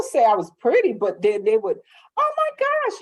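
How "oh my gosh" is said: squealed very high; mock gush. Strangers gushing over her sister, squealing high.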